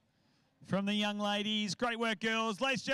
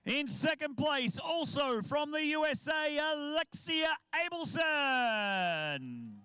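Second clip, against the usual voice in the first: announcer. Acted. Announces award winners.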